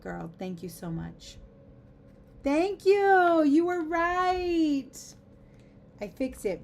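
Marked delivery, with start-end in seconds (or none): 2.4-4.9 high, loud, drawn-out gratitude; gushing thanks. Real, not acted. Streamer thanking a viewer for the correction, loud and gushing. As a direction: gush your thanks, stretching the words.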